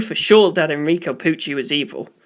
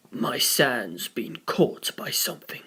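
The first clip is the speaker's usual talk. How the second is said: slow, soft, low whisper; hushed Sandman; 7 semitones lower, 10 dB quieter, slower than usual. Acted. Sandman staring at Mars, quietly reporting his sand is caught. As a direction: breathe it out slowly and quietly.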